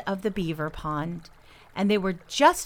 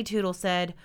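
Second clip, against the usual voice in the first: ordinary delivery, nothing marked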